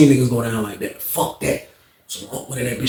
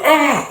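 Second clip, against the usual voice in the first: pained yell; 13 semitones higher than usual. Retold or imitated. Unc stabbed, cries out.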